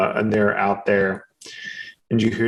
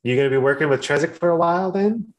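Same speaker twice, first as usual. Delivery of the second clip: friendly, higher NPC voice, rambling and quick; chatty harvest worker Finepe; 4 semitones higher than usual. Acted. Harvest worker chatting up the newcomers, higher and rambling. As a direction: play a rambling, eager-to-please local who loses her words.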